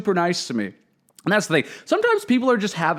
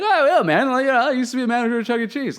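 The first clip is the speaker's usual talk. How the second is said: higher, fast and breezy, light and friendly; fun friendly vibe; 9 semitones higher than usual. Retold or imitated. Replaying his comeback to the grumpy lighting guy, fun and friendly. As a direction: toss it off like a cheerful joke, no bite. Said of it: "kind of like a fun".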